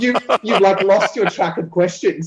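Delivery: laughing. Real, not acted. Teasing the host.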